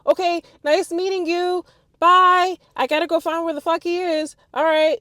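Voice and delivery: breathless hurried goodbye; rushed goodbye. Retold or imitated. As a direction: blurt it out while rushing to leave. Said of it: "I'm rushing".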